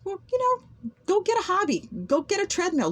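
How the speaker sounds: high sarcastic jab; sarcastic retort. Retold or imitated. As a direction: toss it back with a sneer.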